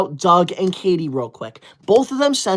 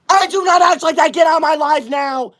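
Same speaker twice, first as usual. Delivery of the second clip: high loud angry order; angry outburst; 7 semitones higher, 4 dB louder than usual. Real, not acted. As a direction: throw the troll out. Ordering troll out.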